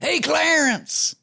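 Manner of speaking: high, slow, dopey rock-star drawl. Retold or imitated. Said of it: "I love doing dumb impressions of [name]".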